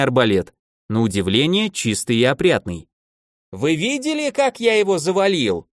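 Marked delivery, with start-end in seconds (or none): 3.5-5.7 high-pitched boast without extra volume; triumphant, mildly roared, high; mild excited roar. A narrator's reading. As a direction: crow it high, a toned-down roar. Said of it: "проревел он".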